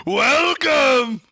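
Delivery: big drawn-out arena welcome; announcer shout. Retold or imitated. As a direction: belt it to the crowd.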